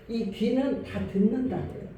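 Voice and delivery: ordinary delivery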